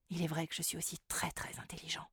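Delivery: whispered boastful aside. Acted. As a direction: whisper it to yourself smugly.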